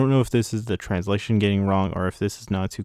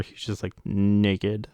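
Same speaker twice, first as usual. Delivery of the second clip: normal talking voice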